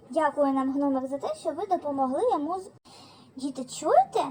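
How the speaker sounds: usual speaking voice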